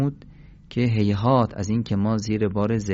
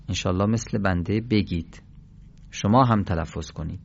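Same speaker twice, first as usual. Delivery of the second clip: ordinary talk, nothing marked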